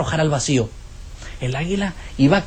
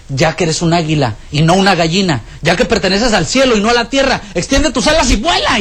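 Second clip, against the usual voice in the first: rising command; 2 semitones higher than usual. Acted. Naturalist holding the eagle on the roof: building, rising command.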